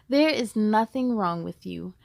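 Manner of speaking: ordinary delivery, nothing marked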